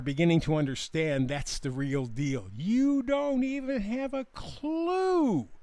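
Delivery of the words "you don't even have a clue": high, slow, sing-song mockery; drawn-out taunt. Host on hidden corruption, high drawn-out taunt. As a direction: stretch it out high and mocking. Mocking what people don't know.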